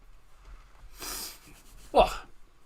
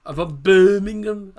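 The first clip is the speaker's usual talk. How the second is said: put-on slow, louder try at a Brummie accent, half-joking; mock Brummie accent; 5 semitones higher, 10 dB louder, slower than usual. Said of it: "I definitely can't do a Brummie accent".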